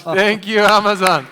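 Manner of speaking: loud, laughing thank-you over the room. Real, not acted. Host thanking the panelist to close, laughing and loud. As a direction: laugh it out while thanking him.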